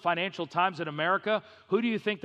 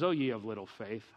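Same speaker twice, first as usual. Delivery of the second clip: lower, softer fatherly rebuke; gentle God voice; 9 semitones lower, 6 dB quieter than usual. Acted. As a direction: chide softly from above. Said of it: "and he says".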